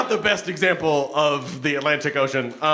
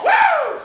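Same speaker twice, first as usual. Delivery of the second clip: loud, very high "woo!"; shrieked party whoop; whooping cheer; 9 dB louder than usual. Real, not acted. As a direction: whoop along with the crowd. Cheering with the audience.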